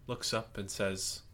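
ordinary talk

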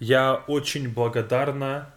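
Я очень благодарна said slowly and clearly; slow model phrase. Shown as a demonstration. Teacher showing the correct phrase slowly. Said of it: "So how we say it is this".

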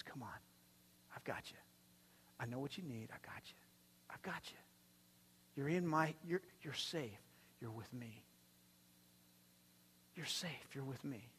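put-on calm a scared child; soft reassurance. Jesus reassuring, voiced softly.

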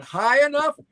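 mock disbelief, pitched high; a viewer asking in disbelief; incredulous viewer. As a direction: play the shocked viewer. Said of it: "everybody out there watching right now is going".

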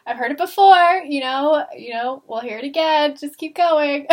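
playfully loud, seen-it-all dismissal; coach brushing off complaints. Retold or imitated. Coach waving off an angry swimmer's yelling, breezily dismissive.

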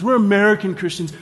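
self-satisfied, sarcastic boast; mock smugness. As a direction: parody a know-it-all believer. Mocks smug Western Christians.

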